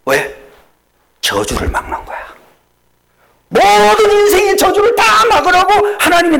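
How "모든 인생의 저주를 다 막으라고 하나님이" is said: high, loud preaching cry; preaching at full force. Acted. Pastor to congregation, crying out in full preaching force. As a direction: lift the congregation with a ringing cry. Preacher shouting his climax.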